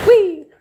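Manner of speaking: high, loud "whee!"; playful whee. Real, not acted. Streamer plugging things in, giving a playful whee. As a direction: let out a playful high squeal. Playful cry while moving gear.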